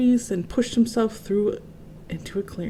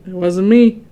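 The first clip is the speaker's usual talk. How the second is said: louder, blunt lion voice; Lion's loud denial; 8 dB louder than usual. A narrator's reading. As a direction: deny it flatly. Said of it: "said Lion".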